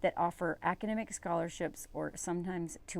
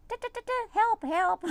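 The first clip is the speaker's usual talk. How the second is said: high, sing-song mock Morse code then a louder "help, help"; pretend distress signal; 14 semitones higher, 4 dB louder than usual. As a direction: tap out a secret plea. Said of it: "Morse code with us, being like".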